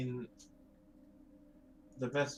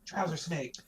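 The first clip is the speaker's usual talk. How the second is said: mock yell, pitched up; in-character yell; 6 semitones higher than usual. Player acts Click calling into the water, mock yell. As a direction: yell it out higher, only half full volume. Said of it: "Click points at Garand in the water and yells".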